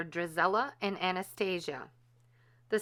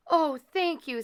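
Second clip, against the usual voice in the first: high delighted thanks; overjoyed thanks; 10 semitones higher, 5 dB louder than usual. A narrator's reading. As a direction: Cinderella thanking her stepmother. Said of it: "said Cinderella".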